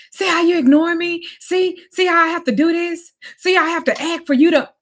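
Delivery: loud, high, accusing rant; angry accusation. Retold or imitated. Boyfriend blaming her for making him act out, loud and high.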